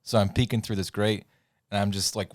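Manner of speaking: normal talking voice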